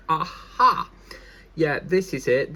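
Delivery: loud, high triumphant aha. Real, not acted. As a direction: found it at last. Finds the x-rays.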